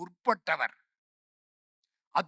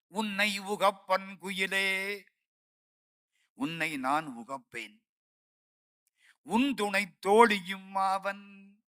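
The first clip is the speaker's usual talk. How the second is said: verse recited slowly, word by word; slow verse recital; slower than usual. Shown as a demonstration. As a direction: intone the hymn line slowly, like quoting scripture. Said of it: "அதுல ஒரு பாட்டு சொல்றேன்".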